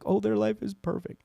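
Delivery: high-pitched mock envy; mock admiration. Millennial comparing self to social media, high mock-admiring voice. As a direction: imitate someone gushing over another's life.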